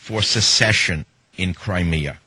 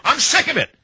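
a sudden high, exasperated outburst; fed up. Real, not acted. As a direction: blurt it out with real exasperation. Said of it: "don't get me started".

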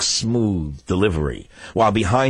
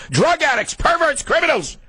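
much higher, piercing insults; shrill insults. Real, not acted. Host branding the networks, high and furious. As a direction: spit out the insults at full pitch. Rant naming networks criminals.

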